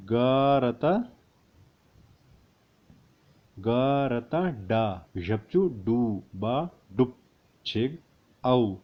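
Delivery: slow, syllable by syllable; slow spelling out. Shown as a demonstration. Teacher spelling letters slowly for students. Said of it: "उसके बाद देखते हैं".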